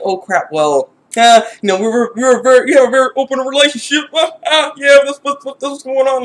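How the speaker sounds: flustered excuse. Retold or imitated. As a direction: scramble to cover the lie. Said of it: "she's kinda like".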